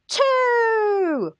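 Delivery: high, long drawn-out "Two"; stretched, hammed-up celebratory call; mock-triumphant quiz answer. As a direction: sing out the answer like a game-show host. Answering own quiz, game-show style call.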